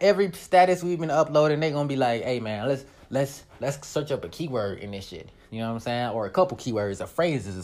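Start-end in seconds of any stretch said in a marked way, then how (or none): 2.2-5.3 low, quiet suggestion; hushed imitation of a planner; imitated official. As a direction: pitch an idea under your breath. Imagined officials planning a keyword search.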